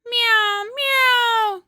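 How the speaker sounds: loud, high pleading meows; crying meow. Acted. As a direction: wail like a shut-out cat. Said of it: "cried Simpkin".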